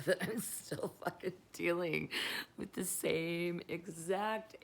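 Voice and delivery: laughing through the words; can't stop giggling at yourself; giggly, stoned disbelief. Real, not acted. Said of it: "just to let you know why I'm laughing so hard".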